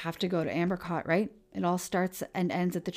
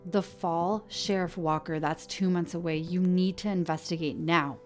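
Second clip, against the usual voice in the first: urgent demand; 2 semitones higher, 5 dB louder than usual. Acted. Kyle demands an investigation.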